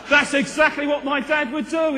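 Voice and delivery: loud, laughing burst of recognition; laughing exclamation. Real, not acted. Pastor laughing loudly at his dad's habit. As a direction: shout it out through a laugh.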